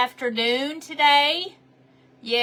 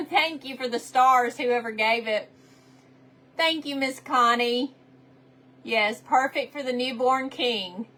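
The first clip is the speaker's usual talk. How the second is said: ordinary delivery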